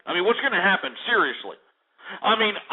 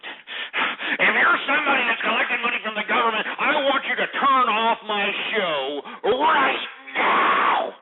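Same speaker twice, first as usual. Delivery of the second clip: raised, worked-up anger, drawn out at the end; angry tirade; 3 semitones higher than usual. Real, not acted. Host orders welfare collectors off his show, irate. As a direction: order them off, stretching the last words.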